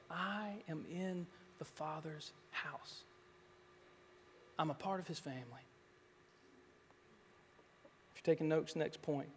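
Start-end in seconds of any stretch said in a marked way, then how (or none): 0.1-5.6 hushed affirmation. Acted. Quiet affirmation to repeat.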